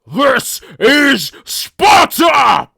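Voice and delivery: Leonidas battle roar. Acted. Leonidas kicking the messenger into the pit, battle roar. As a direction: bellow it like a movie Spartan king. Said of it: "silliest Leonidas I've ever heard".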